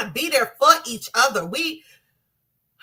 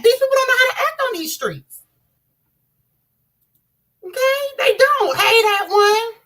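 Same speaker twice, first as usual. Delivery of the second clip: high, loud exasperated yelling; angry outburst; 12 semitones higher, 6 dB louder than usual. Real, not acted. Mother venting to viewers about street violence, shouting high and loud. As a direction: shout your frustration at the whole street.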